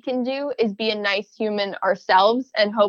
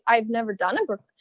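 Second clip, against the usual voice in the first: ordinary talk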